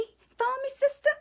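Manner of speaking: sassy high "sister" call; playful sassy check-in. Acted. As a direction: tease the viewers like a girlfriend.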